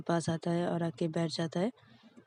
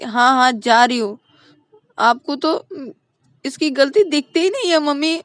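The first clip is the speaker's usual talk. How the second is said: resentful; 6 semitones higher, 10 dB louder than usual. Acted. Ananya resentful.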